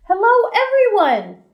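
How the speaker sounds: with excitement. Shown as a demonstration. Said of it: "I made sure to say it with excitement".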